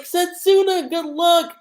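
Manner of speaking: squealing fan. Retold or imitated. Fans cheering Setsuna before the match, high squeal. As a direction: squeal it like an excited fangirl. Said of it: "super, like, squealing".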